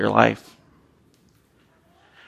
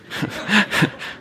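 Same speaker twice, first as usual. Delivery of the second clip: breathy laugh; 11 semitones higher, 5 dB quieter than usual. Real, not acted. Laughs at own confession.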